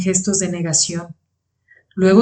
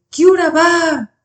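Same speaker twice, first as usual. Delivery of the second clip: high, slow called-out yell; a runners' shout voiced by the narrator; drawn-out cry; 9 semitones higher, slower than usual. A narrator's reading. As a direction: cry it out high, stretching the vowels. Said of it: "oímos otro grito de".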